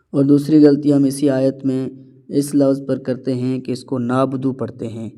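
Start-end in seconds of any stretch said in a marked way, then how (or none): 4.0-4.5 "نابدو" with a long a, no ain; missing ain. Shown as a demonstration. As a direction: slide through "نابدو" as naa-budu. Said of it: "عین ظاہر نہیں ہو رہا". ("نابدو" عین کے بغیر)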